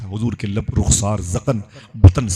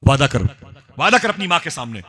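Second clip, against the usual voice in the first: raised-pitch scolding from a furious aunt; acted angry tirade, pitched up on the threats; 3 semitones higher than usual. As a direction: lay down the law to the nephew. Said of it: "खाला मेरी बड़ी गजब में थी".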